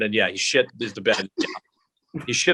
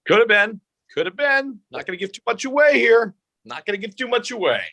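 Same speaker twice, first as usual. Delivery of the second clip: high, playful, mysterious tease; coy teasing; 10 semitones higher, 3 dB louder than usual. Pretend. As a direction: keep the secret with a playful lilt. Teasing about the secret Peter.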